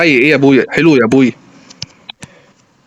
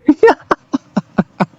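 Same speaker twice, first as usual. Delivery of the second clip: high-pitched staccato laugh; helpless high cackle; high burst of laughter; 9 semitones higher than usual. Real, not acted. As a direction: break into high, choppy laughter. Laughs after his worked-up pleading.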